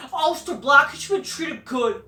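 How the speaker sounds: high, loud mocking fan voice; hammed-up fan complaint; mock heartbroken fans. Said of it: "they're all heartbroken".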